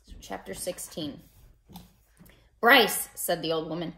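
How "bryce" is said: put-on louder, calling the boy off; sharp call. Old woman calling Bryce away from the rabbit, loud. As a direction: bark his name across the garden.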